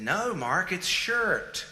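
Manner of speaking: mock-correct a child with a rounded r in "shirt"; retold pronunciation correction. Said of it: "and they said".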